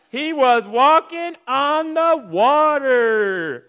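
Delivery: high, loud, very drawn-out wonder; dramatic amazed reveal. Acted. Preacher revealing Jesus walked on water, dramatic amazement. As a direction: stretch every word of the big reveal.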